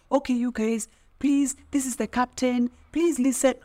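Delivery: high, pleading call for attention; imitated begging MC. MC begging a drinking crowd to listen, pleading. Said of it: "an M C is having to beg you to listen".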